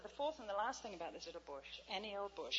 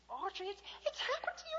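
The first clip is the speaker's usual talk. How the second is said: very high, loud joyful cry; delighted at a friend's conversion; joyful outburst; 13 semitones higher, 6 dB louder than usual. Retold or imitated. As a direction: burst out in delight.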